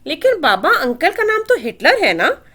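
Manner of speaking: put-on a toddler blinking up at her father; innocent little girl. A narrator's reading. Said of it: "मासूमियत से आंखें पटपटाती हुई बोली".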